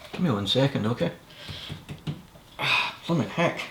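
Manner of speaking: straining at a stuck lid; struggling, out of breath; opening a stuck jar. Real, not acted. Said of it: "I can't get the lid off it now".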